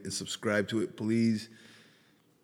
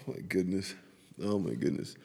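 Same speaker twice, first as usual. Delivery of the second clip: normal talking voice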